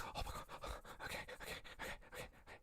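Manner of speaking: barely audible repeated muttering to self; frantic whispering. Acted. Man writes it down in his notepad, frantic whisper. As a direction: whisper under the breath, flustered.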